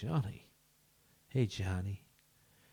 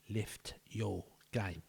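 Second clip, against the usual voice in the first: a slow, drawn-out telling-off; slow stern coach; 5 dB quieter, much slower than usual. Shown as a demonstration. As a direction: drag it out like a disappointed coach.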